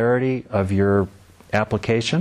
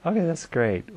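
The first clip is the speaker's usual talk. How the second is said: usual speaking voice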